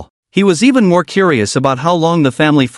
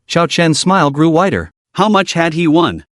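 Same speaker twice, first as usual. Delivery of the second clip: plain reading voice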